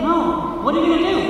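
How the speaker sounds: ordinary talk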